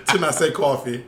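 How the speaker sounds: laughing as they speak; mock their effort, laughing; teasing through laughter. Real, not acted. Friend teasing someone trying not to say coffee with an accent, laughing.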